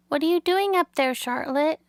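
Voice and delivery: put-on curious young pig. A narrator's reading. Wilbur calls up to Charlotte in the dark, curious. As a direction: call up to her, curious.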